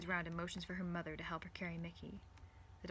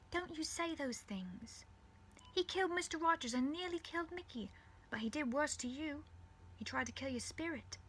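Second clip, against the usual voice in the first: high-pitched motherly voice, earnest; high, insistent mother voice, reassuring; consoling mother; 8 semitones higher than usual. Acted. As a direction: comfort and encourage an upset daughter. Voices Rose's mother consoling her.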